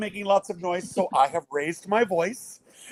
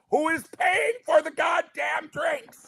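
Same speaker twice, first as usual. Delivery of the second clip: 2 slow, higher, hammed-up outrage; 3 bellow the punchline with comic indignation; 1 mock indignant shout; 9 semitones higher, slower than usual. Real, not acted. 4 father of the groom complains about paying, mock shout.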